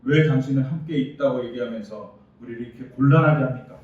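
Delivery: loud, exasperated protest; arguing complaint. Acted. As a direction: demand an answer from God. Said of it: "라고 따지는 거죠".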